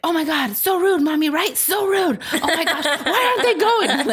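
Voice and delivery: put-on high, whiny kid voice, half laughing; kids' outrage. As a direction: imitate the kids complaining in the car. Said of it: "they're like".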